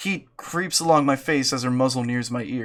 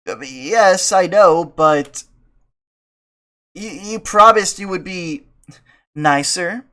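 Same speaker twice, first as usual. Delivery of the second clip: put-on sheepish girl voice; 4 semitones higher, 6 dB louder, slower than usual. Mia voiced.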